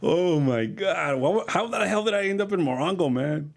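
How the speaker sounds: shocked. Retold or imitated. Waking in Morongo casino.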